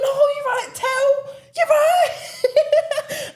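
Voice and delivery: very high, loud squeal through laughter; squealed laughing reply. Retold or imitated. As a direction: shriek it, cracking up. Laughing mock reply to caller.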